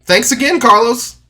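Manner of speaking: very high, sing-song "Will do", forced brightness; fake cheerful reply. Politely answering Carlos after the rejection, fake cheerful. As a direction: chirp it out like nothing's wrong. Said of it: "So I said".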